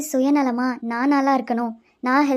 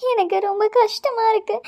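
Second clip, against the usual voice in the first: tearful; 8 semitones higher than usual. Acted. Humiliated after spilling lunch.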